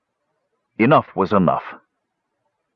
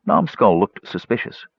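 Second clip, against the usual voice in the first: ordinary delivery, nothing marked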